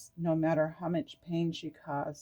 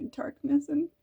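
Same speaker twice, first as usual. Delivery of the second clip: on the edge of real tears, pushing through; tearful; 11 semitones higher, 3 dB louder than usual. Real, not acted. Tarot reader tearing up over her own healing, high and crying. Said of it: "I'm gonna cry".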